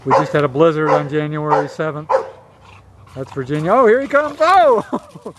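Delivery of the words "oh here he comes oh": high, loud yelp of surprise; real startled whoop, pitch leaping up; startled excitement. Real, not acted. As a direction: cry out as something rushes right at you. Dog suddenly charges at the camera.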